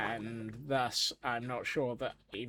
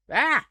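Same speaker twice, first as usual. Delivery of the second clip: loud, high startled cry; startled yelp; 14 semitones higher, 11 dB louder than usual. Real, not acted. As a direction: yelp in surprise.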